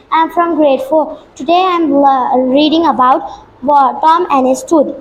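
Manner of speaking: ordinary talk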